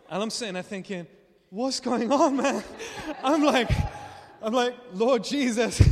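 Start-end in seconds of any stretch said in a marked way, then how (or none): 1.5-5.8 laughing disbelief. Retold or imitated. Baffled by the chaplain's service.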